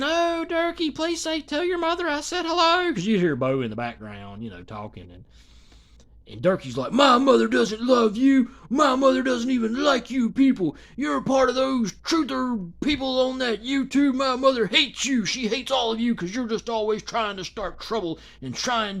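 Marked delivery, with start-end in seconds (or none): 0.0-2.9 high, light fan voice; lightly voiced gushing lady; mock sweet female fan. Voicing the fan asking Durkee to greet his mother, high and sweet. 6.9-19.0 loud, slow, indignant shout; hammed-up angry rant; imitating angry Durkee. Durkee snapping at the fan in chat, loud mock shout.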